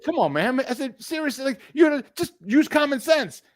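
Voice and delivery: high-pitched pleading disbelief; exasperated appeal. Retold or imitated. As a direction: plead for some sense, exasperated. Retold exasperated appeal to sense.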